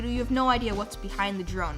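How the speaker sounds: usual speaking voice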